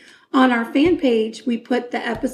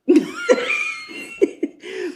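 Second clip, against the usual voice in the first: high squeal laugh. Real, not acted. Laughs off the refusal.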